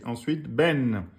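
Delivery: ordinary talk, nothing marked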